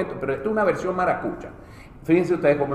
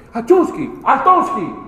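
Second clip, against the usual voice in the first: mock Russian sneeze; 7 semitones higher, 4 dB louder, slower than usual. Sneeze then halt command.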